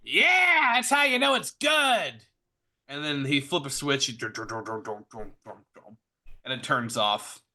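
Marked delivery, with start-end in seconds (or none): 0.0-2.1 shouting back gleefully. Acted. Mechanic shouts back over engine. 4.1-6.0 low "dun dun" fading away; engine sputtering out. Acted. Imitates engine dying after switch-off.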